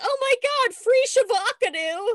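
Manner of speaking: high excited squeal. Retold or imitated. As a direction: gush like a thrilled fan. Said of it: "they're like".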